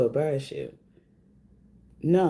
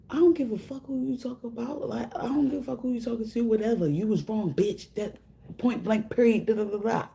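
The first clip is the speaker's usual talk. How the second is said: retold telling off; 6 semitones higher than usual. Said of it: "That's what I said".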